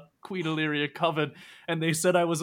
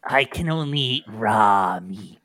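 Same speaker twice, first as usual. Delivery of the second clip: slow, loud wolfish declaration; feral werewolf woman; 6 semitones lower, 5 dB louder, slower than usual. Acted. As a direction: growl each word out slowly. Dog-woman answers in character.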